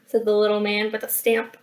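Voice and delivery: ordinary delivery, nothing marked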